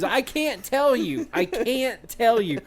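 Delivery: high, slow drawn-out whine; mock distressed kid. Kid repeatedly refusing to tell the dad, mock wail. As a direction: stretch it out like a wailing kid. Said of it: "what he kept telling us".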